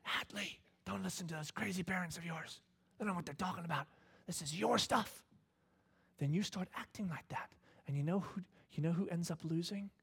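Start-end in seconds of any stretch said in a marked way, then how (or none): 0.0-5.2 soft, sly whisper in her ear; the enemy whispering to the daughter, softer and sly; tempter's whisper. Retold or imitated. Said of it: "he whispers into your ear".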